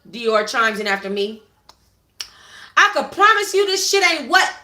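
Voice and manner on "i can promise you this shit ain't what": high, loud angry warning; snapping. Acted. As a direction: snap it down at him. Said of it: "Dior snapped".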